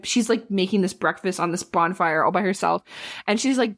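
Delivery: ordinary talk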